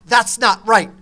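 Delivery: loud, sharp retort from an upset wife; wife shouting that he guessed wrong; angry shout. Retold or imitated. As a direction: bark it back, annoyed. Wife snaps back in retold quarrel.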